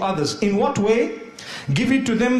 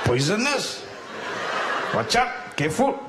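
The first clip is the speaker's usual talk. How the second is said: put-on Malaysian accent; 5 dB quieter than usual. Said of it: "I'm saying it in a Malaysian accent".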